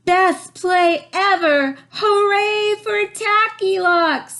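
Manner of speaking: very high, loud, slow cheer. A narrator's reading. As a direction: cheer as a whole class. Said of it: "they cheered".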